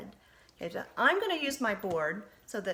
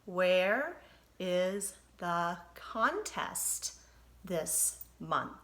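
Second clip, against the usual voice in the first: slow, each word apart for counting; slow choral reading; 3 dB louder, much slower than usual. Shown as a demonstration. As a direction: say it slowly so kids can count words. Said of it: "say that with me".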